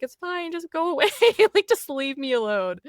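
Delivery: high-pitched, half-laughing exasperation; exasperated plea. Retold or imitated. Acts out pleading with waiter.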